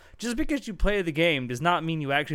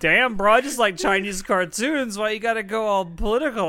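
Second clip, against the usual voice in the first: mocking whine; 7 semitones higher, 4 dB louder than usual. Mocking a defensive fan.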